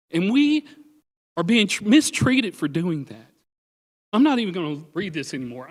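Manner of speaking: an urgent, heartfelt plea for help; getting emotional. Real, not acted. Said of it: "I'm sorry I'm getting emotional".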